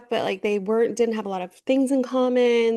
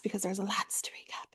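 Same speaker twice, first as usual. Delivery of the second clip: whispered aside; 12 dB quieter than usual. Real, not acted. Aside about Love Island.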